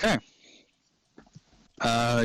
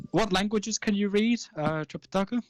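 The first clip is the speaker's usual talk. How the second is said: gnome shopkeeper; 8 semitones higher than usual. Acted. Gnome asks customer.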